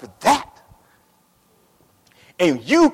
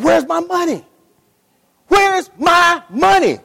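shouted, slowed demand with a higher pitch; angry demand; 7 semitones higher, 4 dB louder than usual. Retold or imitated. Servant seizing his debtor, shouting for his money. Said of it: "He jacked him up. He said".

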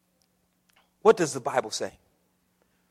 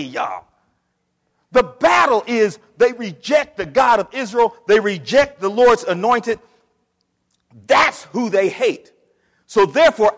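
full-force preaching; 5 semitones higher, 11 dB louder than usual. Acted. Pastor to congregation at the climax, full-force preaching. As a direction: drive it home to the pews.

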